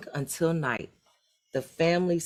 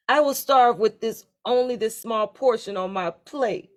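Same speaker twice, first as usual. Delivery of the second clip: complaining; 7 semitones higher, 5 dB louder than usual. A narrator's reading. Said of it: "complained the youngest".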